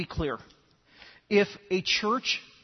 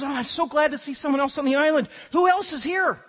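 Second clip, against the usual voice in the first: excited islander; 7 semitones higher, 4 dB louder than usual. Acted. Islander delighted to meet someone.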